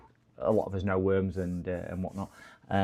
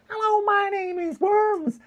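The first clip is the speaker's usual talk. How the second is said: very high put-on squeaky voice; Worms. Imagining how a chat regular speaks, very high silly voice. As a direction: squeak it out as a silly character introduction. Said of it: "So we'd got Worms going".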